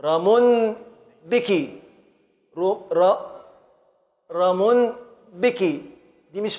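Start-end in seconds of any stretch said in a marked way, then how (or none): 0.0-3.4 metrical scansion. Shown as a demonstration. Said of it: "نقف على الكاف". 4.3-5.6 slow "رمن", pause, then "بك"; drawn-out rhythmic split of "رمن بك"; metrical scansion. Shown as a demonstration. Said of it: "نقف على الكاف".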